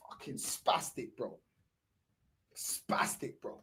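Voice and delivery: breathy whispered insult. Real, not acted. Fan whispers his disgust at the team, whispered.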